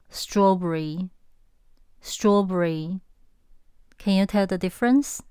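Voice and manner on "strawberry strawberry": strawberry said slowly in two syllables, straw-bry; British two-syllable strawberry, drawn out; 英式发音的strawberry. Shown as a demonstration. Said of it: "但是英国人说".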